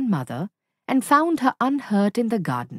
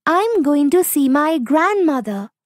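put-on higher, louder little girl voice; 7 semitones higher, 6 dB louder than usual. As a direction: chirp it out like an eager young girl.

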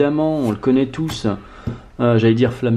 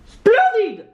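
thrilled squeal. Real, not acted. Thrilled by a pulled card.

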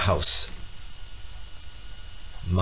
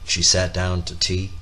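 usual speaking voice

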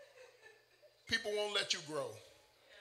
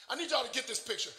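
ordinary delivery

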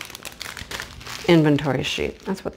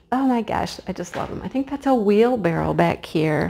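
usual speaking voice

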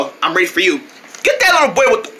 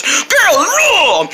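ordinary talk, nothing marked

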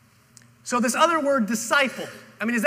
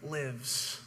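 softer and lower, gentle reassurance; compassionate; 5 semitones lower, 6 dB quieter, much slower than usual. A narrator's reading. Jesus comforts the anxious father, soft and compassionate. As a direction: let it settle softly, full of compassion. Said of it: "looks with an eye of compassion and says".

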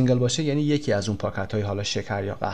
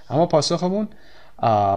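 usual speaking voice

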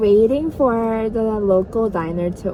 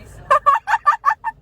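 loud, high squeal of a laugh; high burst of laughter; 6 dB louder than usual. Real, not acted. Laughing at the arm wrestling idea.

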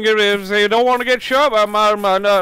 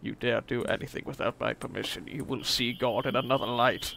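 much lower, soft threatening voice; menacing low whisper; 8 semitones lower, 11 dB quieter than usual. Retold or imitated. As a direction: threaten darkly under breath. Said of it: "It's just a messed up, like".

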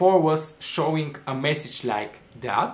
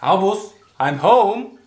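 cheerful loud homecoming call; 4 semitones higher, 6 dB louder, slower than usual. Real, not acted. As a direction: call out to the dog from the door. Calling out coming home.

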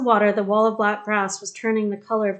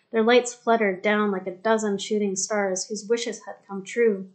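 plain reading voice

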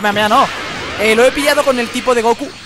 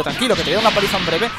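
ordinary talk, nothing marked

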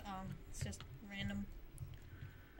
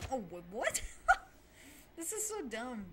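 normal talking voice

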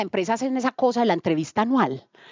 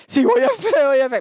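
excited; 7 semitones higher, 4 dB louder than usual. Real, not acted. Declaring faith.

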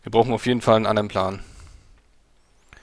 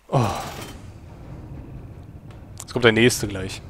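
ordinary talk

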